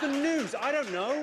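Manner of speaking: loud, high, mock-outraged; exasperated shout. Comic ranting at news shows asking viewers, exasperated shout. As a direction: bellow it like it should be obvious.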